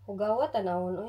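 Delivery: usual speaking voice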